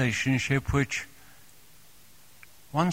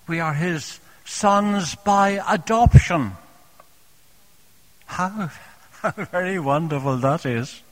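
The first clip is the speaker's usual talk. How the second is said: joyful exclamation; 3 semitones higher, 6 dB louder than usual. Real, not acted. Preacher exults over adoption.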